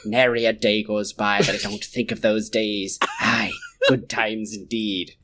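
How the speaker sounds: put-on low, wistful old seadog; old pirate. Reading a pirate-talk text exchange to friends, wistful old pirate. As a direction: reminisce like a seafaring pirate. Reads out pirate role-play messages.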